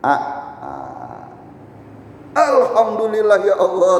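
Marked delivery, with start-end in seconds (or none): 2.4-4.0 loud, high burst of thanks to God; a hungry man shouts his thanks to Allah; joyful shout. Acted. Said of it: "teriak dia".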